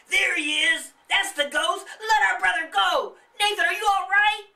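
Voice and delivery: high, loud frantic shouts; hollering. Acted. Charlie bursting in to save Nathan, loud high shouting. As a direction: storm in shouting for your brother. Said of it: "hollered Charlie".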